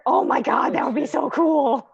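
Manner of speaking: real excitement, pitch lifting as it goes; excited gushing. Real, not acted. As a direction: gush over a brilliant idea. Player thrilled by idea of smashing stone.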